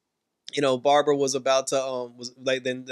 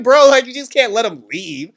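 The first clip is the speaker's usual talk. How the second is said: higher and louder, disbelieving; loud protest; 8 semitones higher, 10 dB louder than usual. Real, not acted. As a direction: yell at the screen in disbelief. Protesting Rick's choice.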